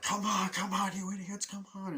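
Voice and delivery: high, loud urging yell; cheering them on. Retold or imitated. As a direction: urge them on, high and pushy. Cheering runners on.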